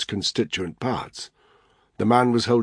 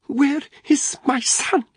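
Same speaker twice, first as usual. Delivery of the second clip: high, slow hiss of a frightened mother; 4 dB louder, slower than usual. Acted. Mother confronting the thug at her door, high slow hiss. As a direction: hiss it, demanding her son back. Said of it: "Anna hissed".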